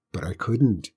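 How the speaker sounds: plain reading voice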